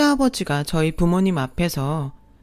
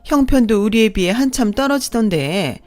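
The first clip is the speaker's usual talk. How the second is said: higher, louder snide stepmother voice; sneering second mother; 5 semitones higher, 4 dB louder than usual. Acted. Second mother mocking the bride's parents, sneering and snide. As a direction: look down on the bride's family with contempt.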